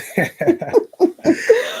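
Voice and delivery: sudden loud high laugh. Real, not acted. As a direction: burst out laughing brightly. Laughs about the book title.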